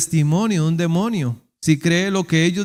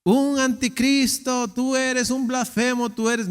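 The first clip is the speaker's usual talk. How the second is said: pitched up, scornful accusation; mock accusing demons; 7 semitones higher, slower than usual. Preacher mimics demons accusing Jesus, pitched high. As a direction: hurl it like a mocking demon.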